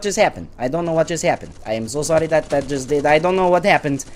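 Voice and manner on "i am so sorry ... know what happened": ordinary talk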